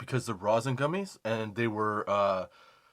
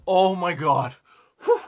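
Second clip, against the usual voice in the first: louder, higher burst of relief; big relief; 6 semitones higher, 8 dB louder, slower than usual. Retold or imitated. Dad hearing it's only a phone, loud relieved gasp. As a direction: let it all out at once. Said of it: "I'm like".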